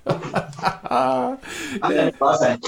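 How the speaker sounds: calling from across the room; shouting from away from the mic; from another room. Real, not acted. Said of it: "ist der Gast plötzlich verschwunden".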